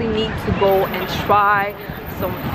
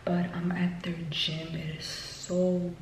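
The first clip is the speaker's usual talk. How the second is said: hushed near-whisper, much softer; 11 dB quieter than usual. Real, not acted. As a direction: keep it hushed so nobody in the building wakes. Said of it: "I feel like I'm whispering".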